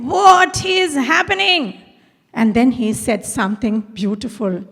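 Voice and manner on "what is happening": high, slow, startled cry of a mother; a mother bursting into the kitchen, alarmed. Retold or imitated. Mother finding her son on the floor, startled and slow.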